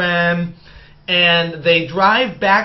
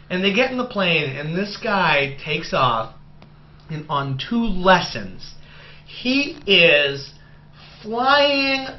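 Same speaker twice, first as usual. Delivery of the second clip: usual speaking voice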